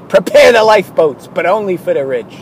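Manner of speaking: loud commanding call; captain's order. Acted. Captain ordering his crew to the lifeboats, loud. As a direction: bark it to the deck.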